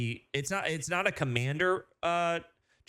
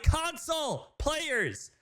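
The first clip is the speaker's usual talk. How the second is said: excited; much slower than usual. Real, not acted. Excited announcement to console players.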